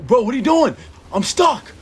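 high, loud frantic yelling; real frantic shouting; panicked yell. Real, not acted. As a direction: scream in panic at being stuck. Stuck in game, panicking.

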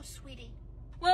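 usual speaking voice

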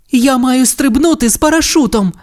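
high, urgent character voice; girl pilot character. A narrator's reading. Ace radios that she must jump, high urgent girl's voice. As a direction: report the emergency high-pitched.

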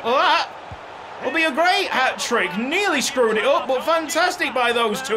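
usual speaking voice

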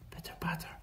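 barely audible whisper; breathy, fading patter. A narrator's reading. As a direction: let the rain fade to a whisper. Rain fading as Ebb sleeps.

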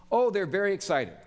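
bursting to tell Jesus the rumours; excited disciples. Retold or imitated. Disciples retold, eagerly answering Jesus.

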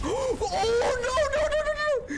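high, crying string of noes; panicked wail. Real, not acted. Player losing the last minis, panicked high wail. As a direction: wail in despair, pitch way up.

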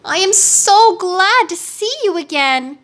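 high-pitched delighted greeting; girlish high voice full of gladness; joyful cry. A narrator's reading. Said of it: "hugging the huge lion joyfully".

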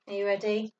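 ordinary delivery

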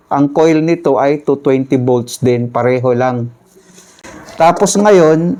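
usual speaking voice

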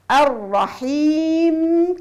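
الرحيم with a long humming mim; held final mim. Shown as a demonstration. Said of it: "الميم لابد نعطيها الزمن".

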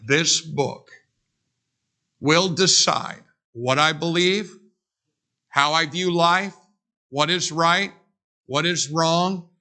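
each phrase set apart, slow; slow declaration. Shown as a demonstration. Preacher giving the church its creed, slow and deliberate. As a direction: declare it slowly, line by line. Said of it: "where we say".

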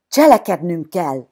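loud rallying cry; shouted call. A narrator's reading. Boy leader urging his friends to act, shouting. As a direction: call the gang to action, loud. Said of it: "kiáltotta Bilibok Valentin".

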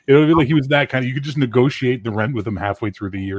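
usual speaking voice

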